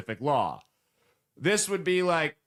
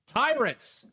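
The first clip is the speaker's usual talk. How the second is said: mocking; 9 semitones higher than usual. Mocking the protesters' word.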